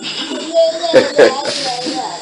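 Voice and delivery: high, soft chuckle; light laugh after a joke; quiet, high-pitched chuckling. Real, not acted. Chuckles after his own imitation.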